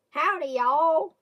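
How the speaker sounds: slow, drawn-out Southern "Howdy, y'all"; Southern drawl. Shown as a demonstration. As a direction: lay on a Southern twang, slowly. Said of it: "That's how I say".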